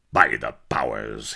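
low, loud pirate roar; gruff pirate bawl. A narrator's reading. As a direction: bellow it like a rough pirate. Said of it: "he bawled".

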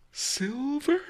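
high, rising breathless question. A narrator's reading. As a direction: gasp the question. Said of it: "he asked breathlessly".

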